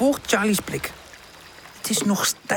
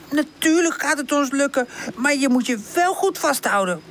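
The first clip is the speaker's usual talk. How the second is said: high, confident character voice; high-pitched encouraging older friend; encouraging Woezel; 8 semitones higher than usual. Acted. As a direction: reassure brightly, then caution.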